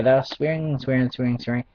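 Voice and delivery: usual speaking voice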